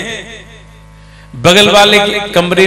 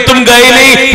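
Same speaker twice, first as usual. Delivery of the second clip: higher, louder startled asking; loud, high surprise; surprised question; 8 semitones higher, 8 dB louder than usual. Acted. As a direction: caught off guard that the guest never left. Surprise at guest returning.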